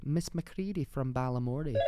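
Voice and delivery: lilting Scottish character voice; name her in a put-on Scottish accent. Co-host naming the Balamory character, Scottish accent. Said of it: "I feel like Miss McCready".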